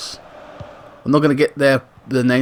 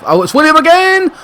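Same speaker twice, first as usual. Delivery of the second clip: high loud sudden cry; soaring commentator yell; excited shout; 7 dB louder than usual. Real, not acted. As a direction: burst out at the surprise chance.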